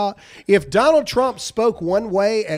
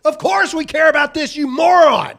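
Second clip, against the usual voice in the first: raised and louder, jabbing at her; worked-up shout; 6 semitones higher, 5 dB louder than usual. Real, not acted. Host yelling at the press secretary on stream, heated. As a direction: let the anger push the pitch up.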